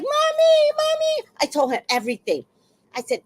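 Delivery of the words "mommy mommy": put-on very high, loud childish cry for mommy; wailing little boy. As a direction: wail for mommy like a scared kid. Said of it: "the boy is like".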